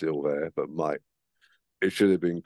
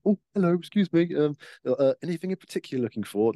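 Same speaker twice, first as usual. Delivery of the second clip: high, quick, overly polite shopkeeper; eager shopkeeper; 8 semitones higher than usual. Retold or imitated. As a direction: chirp it like a keen shop assistant. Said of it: "shopkeepers behind the counter".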